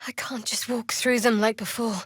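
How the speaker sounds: hushed unvoiced whisper; whispered under the breath. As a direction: breathe it out, drained. Drained companion muttering aside.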